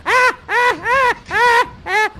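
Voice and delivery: loud, high battle yelps; fighting cries. Acted. Parent mid sword fight, yelping. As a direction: cry out while dodging blows.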